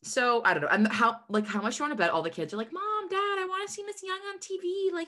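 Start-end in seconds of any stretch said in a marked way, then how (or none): 2.7-5.1 put-on high, excited little kid begging; whiny kid asking Mom and Dad. Kids begging parents, imitated.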